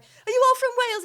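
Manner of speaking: excited. Retold or imitated. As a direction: call out to them in breathless hope, acted. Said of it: "I was so excited".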